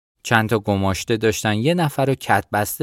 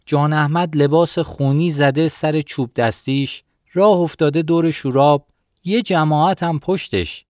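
raised, urgent headman's voice, pitched up; alarmed village headman; 8 semitones higher than usual. A narrator's reading. Frantic headman telling Jabbar of the uprising, urgent and raised. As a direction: rush the bad news out to the master.

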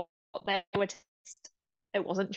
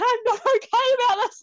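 high, loud panicked laugh; 7 dB louder than usual. Retold or imitated. Mom panicking to Adam about the lost shield. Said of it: "And I'm like".

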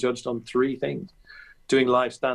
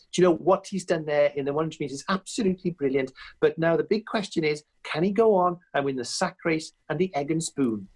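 high excited sports commentator; commentary box voice, pitched up; Colin Jackson impression; 8 semitones higher than usual. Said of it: "you'll hear Colin Jackson in the commentary box saying".

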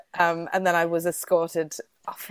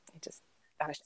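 hushed, voiceless aside; whispered punchline; 3 semitones lower, 15 dB quieter, faster than usual. Real, not acted. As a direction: drop to a near-silent whisper. Punchline of the disappearing boy.

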